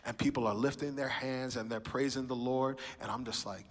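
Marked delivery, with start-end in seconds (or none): none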